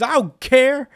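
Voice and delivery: very high, loud pretend outburst; defiant mock yell. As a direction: yell it like a hammed-up anime hero. Acts out hero's defiant retort.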